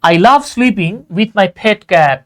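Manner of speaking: very slow, word-by-word dictation pace; teacher reads the example very slowly; said with emphasis. Shown as a demonstration. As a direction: spell the sentence out slowly for students. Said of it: "জোর দিয়ে বলতেছি".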